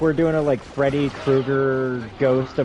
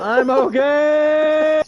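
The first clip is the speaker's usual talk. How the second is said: very slow, high, loud yell; drawn-out shout; 13 semitones higher, 8 dB louder, much slower than usual. Pretend. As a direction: stretch the shout out as you fly off. Character flung away in game.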